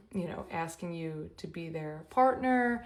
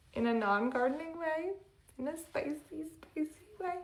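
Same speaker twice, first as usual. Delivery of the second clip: playful giggle; 9 semitones higher than usual. Real, not acted. Playful innuendo about spicy energy.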